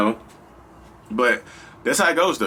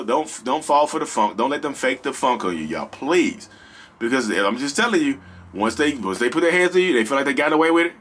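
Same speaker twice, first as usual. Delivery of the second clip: ordinary talk, nothing marked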